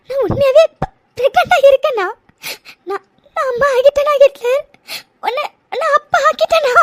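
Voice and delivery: tearful, disbelieving questions; weepy wonder, catching on words; crying. Acted. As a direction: stammer through happy sobs. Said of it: "நீ என்னடா இப்படி அழுதுட்டு இருக்க?".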